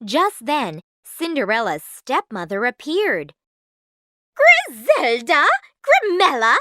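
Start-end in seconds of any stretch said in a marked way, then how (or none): 4.4-6.6 high, loud call to daughters; stepmother's excited cry. A narrator's reading. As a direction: sing out both names. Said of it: "she cried".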